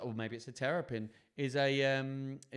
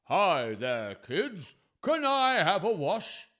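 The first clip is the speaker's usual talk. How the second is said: loud, drawn-out lion voice rising higher; lion character; 5 semitones higher, 10 dB louder, much slower than usual. Acted. Lion asking children for a wash, big character voice. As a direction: boom it out like a friendly lion.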